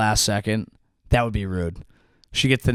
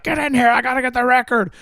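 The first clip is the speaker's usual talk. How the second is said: mock eager command; 9 semitones higher, faster than usual. Lily urging, pretend quote.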